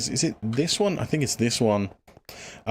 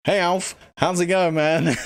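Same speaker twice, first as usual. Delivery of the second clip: laughing greeting; 6 semitones higher than usual. Real, not acted. Greeting a chat viewer, laughing.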